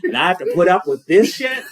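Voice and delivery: exasperated mom, laughing; laughing mom rant. Acted.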